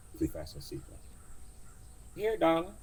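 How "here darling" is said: higher, cheery auntie order; old cab driver. Acted. Driver telling passenger to buckle up, high cheery voice. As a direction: chirp the safety rule.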